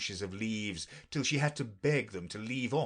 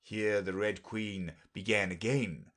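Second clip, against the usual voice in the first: ordinary delivery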